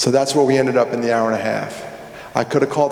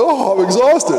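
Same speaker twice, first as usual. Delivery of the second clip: raised, exaggerated weary complaint; mock exhausted groan; 7 semitones higher, 3 dB louder than usual. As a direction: play up being worn out. Acting out post-talk exhaustion.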